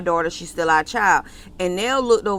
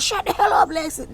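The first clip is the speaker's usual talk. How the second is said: high, sharp mock yell at her sister; mock angry yell; 9 semitones higher than usual. Sister mid-quarrel yelling at Lexi, mock angry. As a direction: snap it at her like a heated sibling.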